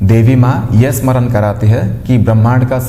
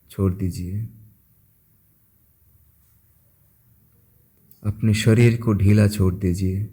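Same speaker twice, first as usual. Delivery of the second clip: soft, unhurried voice with long pauses; guided meditation; 7 dB quieter than usual. Acted. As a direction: guide listeners gently into stillness, phrase by phrase. (शांत, धीमे स्वर में ध्यान कराते हुए)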